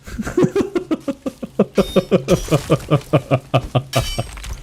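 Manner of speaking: high bursting laugh. Real, not acted. Laughing after joke.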